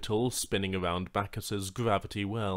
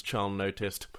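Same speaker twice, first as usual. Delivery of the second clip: ordinary delivery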